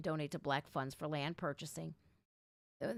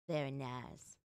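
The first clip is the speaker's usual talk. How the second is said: sarcastic; 7 semitones lower, 7 dB quieter, slower than usual. Real, not acted. Sarcasm after unanswered questions.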